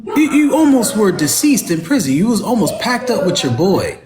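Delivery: high-pitched, indignant disbelief; real exasperated incredulity; incredulous rebuke. Real, not acted.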